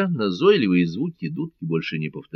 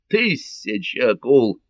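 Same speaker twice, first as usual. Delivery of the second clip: slow, higher-pitched sailor's oath; exclamation; 4 semitones higher, 3 dB louder, slower than usual. A narrator's reading. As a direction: exclaim it with drawn-out astonishment. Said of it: "воскликнул Кармо".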